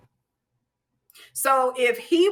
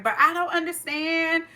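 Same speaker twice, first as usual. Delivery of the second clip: higher-pitched complaint, replaying your younger self; mock whining; 5 semitones higher than usual. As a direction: lightly whine it, tailing into da-da-da. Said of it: "Stop whining and crying about it".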